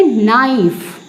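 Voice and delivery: नाइफ said as plain naif, no k at the start; silent k knife. Shown as a demonstration. Teacher to students, showing the right reading, silent k. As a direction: answer नाइफ with the k left silent. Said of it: "तो इसको हम क्या पढ़ेंगे".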